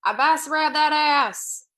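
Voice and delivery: loud, slow punchline; slow shouted pun. Acted. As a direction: belt the pun out slowly. Pun on dad's name.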